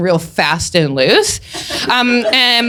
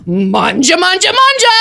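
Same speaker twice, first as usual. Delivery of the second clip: greedy chant climbing higher; gluttony impression; 6 semitones higher than usual. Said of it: "I'll just do a quick impression".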